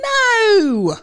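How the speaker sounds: high, loud, drawn-out no; incredulous, exaggerated exclamation; emphatic denial. Real, not acted. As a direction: protest as if the idea is absurd. Exaggerated denial to friend's question.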